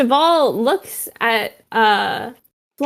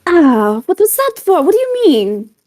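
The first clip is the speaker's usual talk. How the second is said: louder, higher, startled objection; indignant protest; 6 semitones higher, 5 dB louder than usual. Acted. Pedro reacts to a slap on the arm, indignant. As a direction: protest the slap loudly, voice jumping up.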